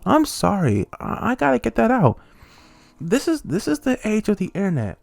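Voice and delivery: put-on rising outrage at rude kids; angry rant. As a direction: work yourself into a fury at them. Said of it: "I, I'm getting angry".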